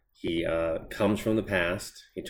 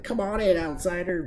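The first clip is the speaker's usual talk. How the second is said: much higher, cheesy sing-song invitation; mock sing-song welcome; 12 semitones higher than usual. Host mocks the woman Steel's sudden welcome, high sing-song. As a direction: chirp it like an overeager hostess. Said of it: "it's like".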